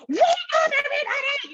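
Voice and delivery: high, loud mock shout; a hammed-up cheery wake-up call; director's wake-up shout. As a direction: bellow it like a chipper director at 8 AM. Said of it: "the director's like".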